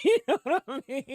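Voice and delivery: quick line breaking into long laughter; laughing through words. Real, not acted. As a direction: crack up as you say it. Cracking up at her story.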